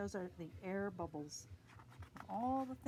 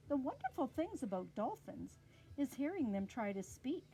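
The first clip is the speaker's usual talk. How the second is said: plain reading voice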